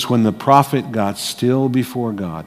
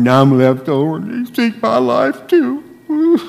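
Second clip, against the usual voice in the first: high, whiny complaining voice; mock Elijah whining pitifully; hammed-up whiny prophet; 11 semitones higher than usual.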